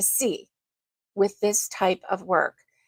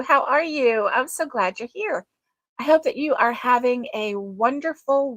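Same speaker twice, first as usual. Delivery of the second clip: normal talking voice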